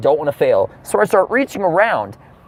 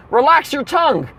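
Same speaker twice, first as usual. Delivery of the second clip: higher-pitched imitation of a stewardess; urgent stewardess; 7 semitones higher than usual. Stewardess instructing a supposedly choking passenger, urgent imitation. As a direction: give a mock emergency instruction. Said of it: "And she says".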